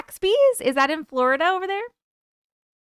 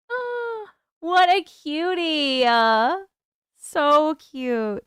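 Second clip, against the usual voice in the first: cooing; 4 semitones higher, much slower than usual. Real, not acted. Cooing at dog photo.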